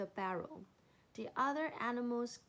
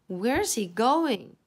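loud wondering; 15 dB louder, slower than usual. A narrator's reading. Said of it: "animals wondered".

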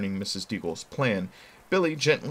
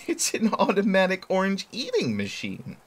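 amused mother; 11 semitones higher than usual. Acted. Mother voiced, laughing.

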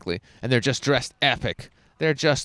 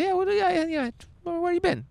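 much higher, gushing yeah yeah; eager excitement. Pretend. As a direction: ask brightly, egging her on. Excited reply to traveller.

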